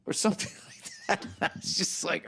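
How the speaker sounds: laughing through the words. Real, not acted. Comedian laughing as he retells the fan. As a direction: giggle it out.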